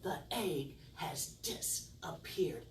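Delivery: slow hushed whisper. Acted. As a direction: whisper the reveal slowly. Magic reveal to children.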